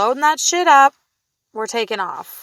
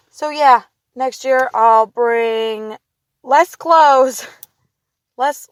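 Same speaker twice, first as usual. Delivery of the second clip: normal talking voice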